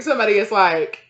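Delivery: ordinary delivery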